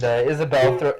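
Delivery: ordinary talk, nothing marked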